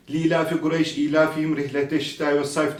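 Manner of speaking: "ilafi" and "ilafihim" with short opening i; elongation skipped. Shown as a demonstration. Quran teacher imitating hurried readers, elongations skipped. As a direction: softly rattle off the verse without stretching vowels. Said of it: "Buradaki uzatmayı yapmıyoruz".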